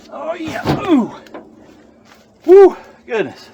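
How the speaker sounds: high, loud whoops of exertion; real puffing, catching breath; winded. Real, not acted. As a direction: blow out hard after a tough climb. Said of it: "I had to catch my breath after that".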